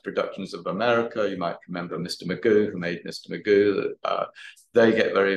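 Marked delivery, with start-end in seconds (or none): none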